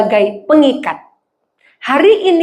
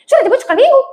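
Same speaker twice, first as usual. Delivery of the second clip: much higher and louder question that sounds like a scolding; loud, high demand; 11 semitones higher, much faster than usual. Retold or imitated. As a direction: fire the question like a telling-off. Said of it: "Kayak dimarahin rasanya". (tante bertanya keras seperti memarahi)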